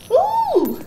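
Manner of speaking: high, sudden "ooh"; high-pitched ooh. Real, not acted. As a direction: let out a high hoot of surprise. Startled exclamation while playing game.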